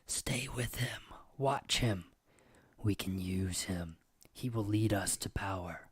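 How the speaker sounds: breathy whispered demon voice; demon whispers. Acted. Demon whispering to the player, urging him on. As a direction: hiss it like a voice in his head. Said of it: "you hear whispers".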